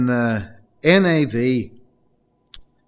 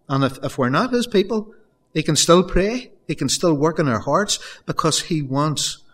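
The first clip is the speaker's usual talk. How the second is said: impassioned preaching; 4 semitones higher than usual. Acted. Preacher urging the congregation that Jesus cares, impassioned. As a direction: press the congregation with urgent conviction.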